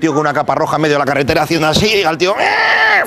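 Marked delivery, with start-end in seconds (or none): 2.4-3.0 high squealing brake noise; imitated brake screech. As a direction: squeal it like tyres braking hard. Braking car on dark road.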